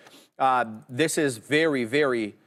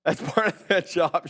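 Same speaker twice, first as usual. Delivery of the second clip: quoting Dara; 2 semitones higher than usual. Retold or imitated. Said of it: "she says".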